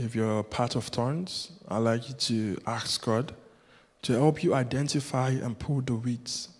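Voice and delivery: usual speaking voice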